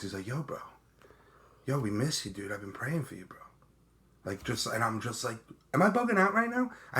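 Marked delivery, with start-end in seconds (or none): 0.3-3.4 soft, trailing humble-friend impression; Brandon's mellow voice. Imitating his humble sponsor greeting him, soft and mellow. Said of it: "This is how he talks". 5.7-6.7 high-pitched disbelief; retold astonishment. Recalling running into a long-lost sponsor, high and astonished. Retold amazement at meeting old friend.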